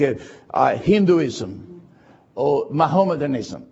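ordinary talk

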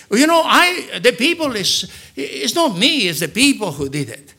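high, stammering mock protest; acted blame-shifting, pitch jumping up then settling; defensive excuse-making. Saul blaming the people before Samuel, high defensive protest.

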